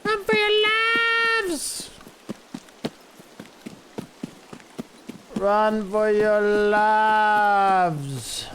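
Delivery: high, slow panicked yell; yelling while running away. Pretend. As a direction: scream it as you flee in terror. Fleeing in game, yelling.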